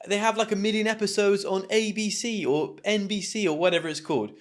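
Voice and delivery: a hint of a smug fan objecting; imagined viewer. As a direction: voice the skeptical viewer, quickly. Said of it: "what you're probably thinking".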